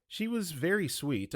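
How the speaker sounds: ordinary delivery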